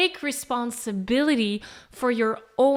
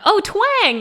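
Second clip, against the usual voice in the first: high, loud burst of delight; excited discovery; 9 semitones higher, 8 dB louder than usual. Pretend. Singer spotting twang in her backpack, excited. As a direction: pop up with a sudden bright idea.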